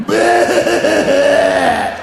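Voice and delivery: high, loud, shouted bleat; ugly sheep bleat. Acted. Disgusting farm sheep bleating at visitors, loud and high. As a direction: blurt it like a fat farm sheep. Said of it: "they're like".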